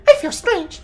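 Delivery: very high, squeaky creature voice; Pikachu. Pretend. Player tries out a voice for reading a game log, hammed-up high squeak. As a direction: squeal it loud and high like a cartoon pet. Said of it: "give it a Pikachu voice".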